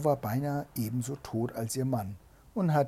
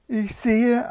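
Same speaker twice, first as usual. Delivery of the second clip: high, loud lookout's call; excited calling; 11 semitones higher, 10 dB louder than usual. A narrator's reading. Sister reports from the tower, calling out. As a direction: call out the sighting from above.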